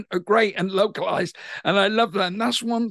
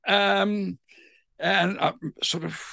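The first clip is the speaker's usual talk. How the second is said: ordinary talk, nothing marked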